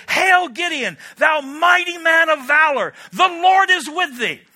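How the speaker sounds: loud, high heraldic call, the angel hailing; booming angel proclamation. Acted.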